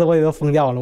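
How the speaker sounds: usual speaking voice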